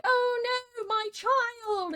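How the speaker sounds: very high, hammed-up wail; mock wailing. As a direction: wail like a melodramatic bereft mother. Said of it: "she wept so bitterly".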